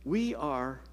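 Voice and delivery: ordinary talk, nothing marked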